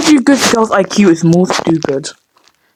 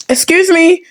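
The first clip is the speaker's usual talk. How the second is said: indignant surprise; 7 semitones higher than usual. Real, not acted. Game does something unwanted.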